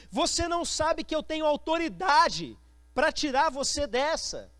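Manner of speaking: high, forceful, indignant question; exasperated authority. Acted. Pilate pressing Jesus about his power, high and exasperated. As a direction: raise the voice, urgent and insistent.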